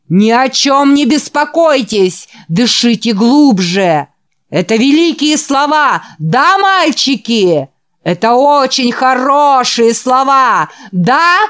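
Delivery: loud, high revival preacher voice; fiery preaching. Acted. The preacher works up the boys in the hall with fiery preaching. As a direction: whip up the congregation.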